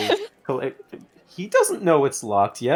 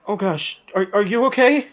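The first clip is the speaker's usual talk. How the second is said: high, startled, stammering worry; alarmed concern; 10 semitones higher, 8 dB louder than usual. Acted. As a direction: blurt it out high and loud in alarm. Friend startled by Maxwell's gasping collapse.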